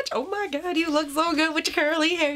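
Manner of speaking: gushing, squeaky compliment; high fawning voice. Pretend. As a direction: coo over her curly hair. Fawning over herself in mirror.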